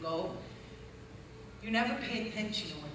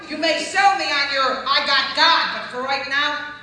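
loud, high, building challenge; fiery preacher roar; preaching shout; 6 semitones higher, 13 dB louder than usual. Real, not acted. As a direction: shout it at the doubters, rising. Preaching at full force.

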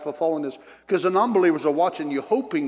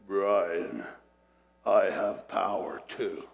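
deep, slow demonic man's voice; demon's man voice; 8 semitones lower, 4 dB quieter, much slower than usual. Retold or imitated. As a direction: let a low demon voice speak out of her. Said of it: "a man's voice in perfect English".

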